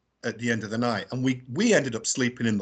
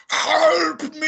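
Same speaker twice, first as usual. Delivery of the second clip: high, drawn-out wailing plea for help; ghostly voice; 13 semitones higher, 6 dB louder than usual. Retold or imitated. Imitating a voice heard at night, ghostly wail. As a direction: wail it like an imitation spirit in distress. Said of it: "I can't even copy it, but the voice was going".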